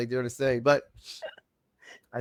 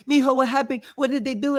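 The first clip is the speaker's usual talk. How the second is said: imitating his panicked mom, much higher and louder; worried mom; 13 semitones higher, 6 dB louder than usual. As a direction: play the frantic mother greeting her son. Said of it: "But it was more like".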